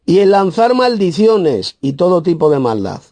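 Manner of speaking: high, proclaimed verse reading; emphatic scripture reading. Real, not acted. Preacher reads the verse to listeners, high and emphatic.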